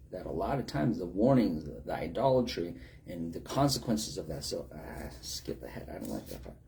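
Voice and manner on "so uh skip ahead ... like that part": muttered skipping. Pretend. Mock reader skipping scary verses.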